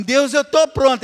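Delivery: loud, high mock declaration; confident shout. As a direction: proclaim it proudly. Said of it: "Quando você fala assim". (declarando alto e confiante)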